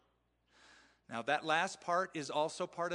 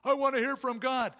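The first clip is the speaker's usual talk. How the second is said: much higher and louder, gushing; mock eager; 10 semitones higher, 8 dB louder than usual. Eager believer wanting to hear from God, mock gushing. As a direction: gush like a naive eager believer.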